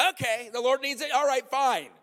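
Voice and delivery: high, loud hammed-up shrug; mock resigned giving-in. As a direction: give up your car with a sigh of resignation. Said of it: "And they're like".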